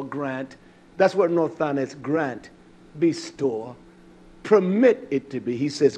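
normal talking voice

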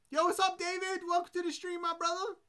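high, upbeat welcome shout-out; hyped greeting. Real, not acted. Streamer welcoming viewer David to the stream, upbeat. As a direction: greet him like a good friend.

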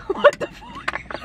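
very high, slow, laughing "What the fuck"; laughing disbelief. Real, not acted. Laughing at herself in post-surgery glasses. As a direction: squeal it through laughter. Laughing at her own look.

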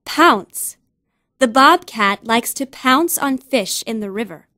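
normal talking voice

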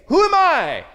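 high, loud, strained cry of self-doubt; anguished cry. Shown as a demonstration. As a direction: wail the question as a doubting believer. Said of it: "When I say".

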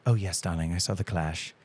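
lightly imitated soft English accent; a hint of gentle English in a retold voice. Mom answering her kid about The Clash, lightly imitated English accent. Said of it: "this really soft English accent".

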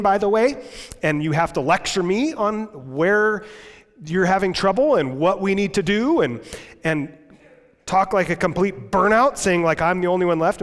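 exasperated God. Acted. God scolding the griping prophet, exasperated and loud. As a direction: scold him like a fed-up boss.

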